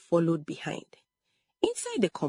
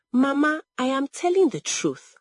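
high, louder appeal; pleading insistence; 8 semitones higher, 3 dB louder than usual. A narrator's reading. Accused daughter pleads with her mother, insistent. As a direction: plead to be believed.

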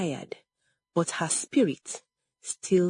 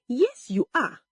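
high, quick contradiction; insistent retort; 7 semitones higher than usual. A narrator's reading. Sister contradicts accused sister, insistent retort. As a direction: fire back with raised pitch.

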